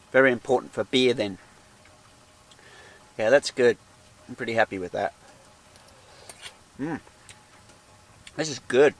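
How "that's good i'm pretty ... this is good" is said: talking through a mouthful; words round a bite of food; mouth full. Real, not acted. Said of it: "Mum said don't talk with your mouth full".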